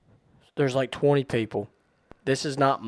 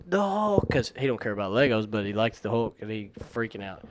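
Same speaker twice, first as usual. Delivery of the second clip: usual speaking voice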